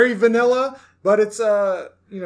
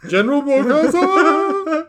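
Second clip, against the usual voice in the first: high, slow, hammed-up German accent; 8 semitones higher, 3 dB louder, slower than usual. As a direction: call out the name in mock German. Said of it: "There's my German".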